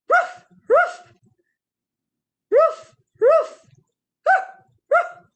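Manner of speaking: very high, loud yapping barks; loud high puppy barks; dog bark. Shown as a demonstration. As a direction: yap like a small dog. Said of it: "What kind of animal would make that sound".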